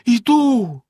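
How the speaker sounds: high, loud despairing cry; desperate. A narrator's reading. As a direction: call back in despair. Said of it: "розпачливо сказала дружина".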